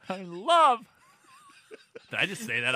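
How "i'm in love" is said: lovestruck movie voice. Acted. Quoting a movie character, laughing.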